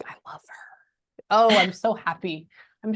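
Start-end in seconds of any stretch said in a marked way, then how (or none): 0.1-1.2 slow, very soft whisper; hushed, slow murmur under the breath; whispered aside. Real, not acted. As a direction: whisper it to yourself. Aside while listening to spirit.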